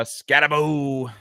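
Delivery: drawn-out comic name call, lightly impersonated; Adam Sandler. Acted. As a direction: stretch the last vowel like a bad celebrity impression. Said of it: "as Adam Sandler might say".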